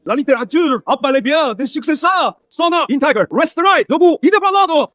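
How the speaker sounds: high, ringing declaration; defiant proclamation. Acted. Student defends literature to a scornful professor, defiant proclamation. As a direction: proclaim it proudly against contempt. Défend fièrement la littérature.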